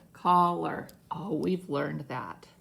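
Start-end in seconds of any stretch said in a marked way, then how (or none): 0.1-0.9 collar stretched into two clear syllables; slow syllable split. Shown as a demonstration. Teacher dividing syllables for pupils, slow collar. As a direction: say "collar" slowly to show where it divides. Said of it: "see where the syllable splits".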